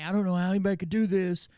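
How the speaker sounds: high, loud, fast whiny self-pity; mock innocent. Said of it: "then they say".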